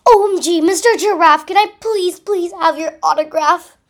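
high, loud fangirl squeal; excited squeal. A narrator's reading. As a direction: squeal it out in starstruck delight. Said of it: "he belted out".

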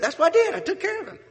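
very high, loud, breaking into laughter; laughing punchline. Retold or imitated.

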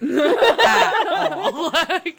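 real laugh breaking into the words, high and loud. Real, not acted. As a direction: crack up as you finish the thought. Laughs through the storm understatement.

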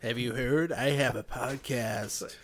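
slow, hushed, bored ironic drawl; slightly creepy hipster podcast host voice; hipster podcaster voice. Acted. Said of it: "That's my hipster podcaster voice".

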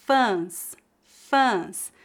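Fãs with a nasal ã. Shown as a demonstration. Teacher models Fãs for learners, nasal A. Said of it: "feita com o A nasal".

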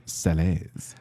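"salées" said the French way, soft and falling; French pronunciation. Shown as a demonstration. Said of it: "French term said in French".

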